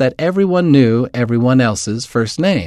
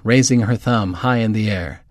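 ordinary delivery

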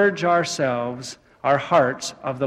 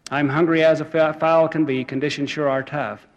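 normal talking voice

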